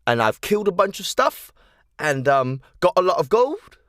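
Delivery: high, loud bragging; proud boast. Acted. Snow boasting of her adventures to the children, high and loud. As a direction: puff up and boast to the kids.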